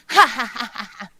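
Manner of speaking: loud gloating ha ha ha; villain cackle. Acted.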